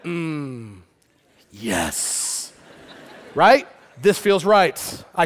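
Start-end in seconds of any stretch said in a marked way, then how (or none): 0.0-2.5 very low, soft, satisfied hum; deep hushed mock approval; relishing murmur. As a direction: savor it like a good meal. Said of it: "you kinda went".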